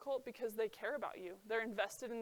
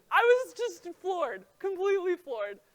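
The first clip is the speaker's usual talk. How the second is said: amused disbelief; 8 semitones higher, 11 dB louder than usual. Real, not acted. Still laughing at memory.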